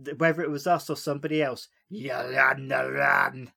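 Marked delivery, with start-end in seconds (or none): 1.9-3.5 put-on Scottish accent, run with an open a. Host repeats Daisy's line in a Scottish accent. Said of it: "I'm quarter Scottish".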